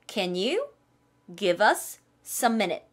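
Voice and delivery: very slow, each word spelled out; drawn-out teaching read; slow model line. Shown as a demonstration. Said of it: "다시 한번". (아주 천천히 또박또박)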